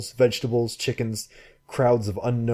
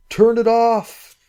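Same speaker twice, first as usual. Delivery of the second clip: louder, even higher, slow desperate yell; slower desperate shout; 14 semitones higher, 9 dB louder, slower than usual. Acted. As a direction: drag each word out, pleading loudly. Frantic shouts at drifting boat.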